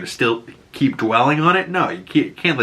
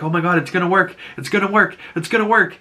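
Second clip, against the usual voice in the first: rising, high-pitched imitated excitement; mock excited anticipation; 7 semitones higher, faster than usual. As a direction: build hope higher with each repeat. Team cheering on the flight.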